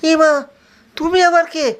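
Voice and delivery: high startled cry. Acted. Disciple startled to find Rama eating, high and shocked. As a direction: jump in surprise at a stranger.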